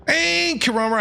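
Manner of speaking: usual speaking voice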